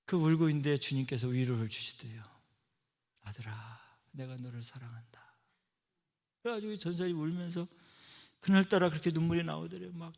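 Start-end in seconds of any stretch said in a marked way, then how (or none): 3.2-5.3 soft, low whisper of comfort; God consoling the crying pastor, whispered; tender whisper. Retold or imitated. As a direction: comfort a weeping son in a hush.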